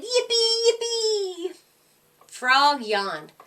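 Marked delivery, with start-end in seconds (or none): 0.0-1.6 high, excited squeal of joy; shouted. A narrator's reading. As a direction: cheer it out like an overjoyed little one. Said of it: "Her baby shouted".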